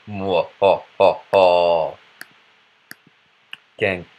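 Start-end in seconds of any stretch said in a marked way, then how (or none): 0.1-2.0 slow "mwo ho ho" stage laugh; mock villain laugh. Bad French accent man gloating, mock villain laugh.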